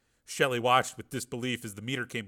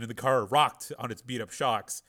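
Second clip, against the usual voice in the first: ordinary delivery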